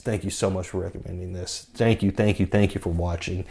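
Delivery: soft, choked-up thanks after crying; snotty and teary. Real, not acted. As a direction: thank them through tears, quietly. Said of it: "kinda snotty and teary".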